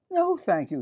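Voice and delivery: put-on polite, high little-girl voice; Millie. Millie turns down the box, high girlish voice. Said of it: "said Millie".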